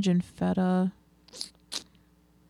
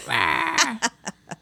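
laughing; 6 semitones higher than usual. Real, not acted. Laughing after the bit.

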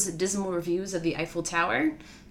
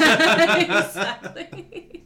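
laughing; 9 semitones higher, 6 dB louder, much slower than usual. Real, not acted. Laughing at a joke.

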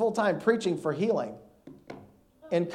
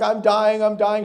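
panicked; 5 semitones higher, 6 dB louder, slower than usual. Retold or imitated. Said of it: "I'm still saying I'm dying".

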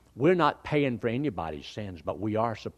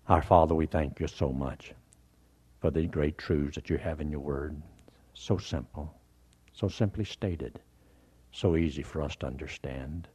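closing prayer; 6 semitones lower than usual. Real, not acted.

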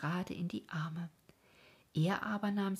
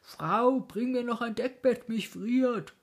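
higher, louder cry, shivering with cold; teeth-chattering call; 6 semitones higher, 8 dB louder than usual. Acted. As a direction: call out to his wife, freezing. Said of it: "klappert mit den Zähnen und ruft".

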